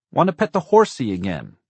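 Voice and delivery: put-on high, loud little-boy shout; child's shout. A narrator's reading. Four-year-old in the back seat demanding the horse, shouted. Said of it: "Blake shouted".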